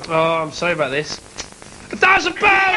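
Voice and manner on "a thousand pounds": high, triumphant shout; thrilled game-show reveal; excited prize announcement. Real, not acted. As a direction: announce the jackpot with a big yell. Host announces the winning prize.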